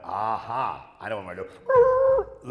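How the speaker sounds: hammed-up sly eureka; mock eureka. Hatching a getaway plan.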